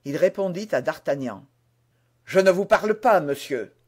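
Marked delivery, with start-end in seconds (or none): 2.2-3.7 ironic insolence. A narrator's reading. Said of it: "avec un accent d'ironie et d'insolence".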